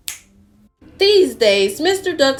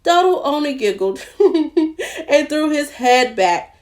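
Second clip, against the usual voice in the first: higher, playful narration with an acted giggle; story reading to children; 4 semitones higher than usual. A narrator's reading. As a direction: tell it merrily, giggling for the duck. Reads a picture book to children.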